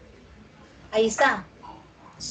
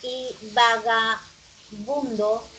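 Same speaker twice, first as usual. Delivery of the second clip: vagabundo drawn out slowly, the B sounds clear; slow demo; much slower than usual. Shown as a demonstration. Teacher to class, comparing letters: slow demonstration. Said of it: "tengo el mismo sonido".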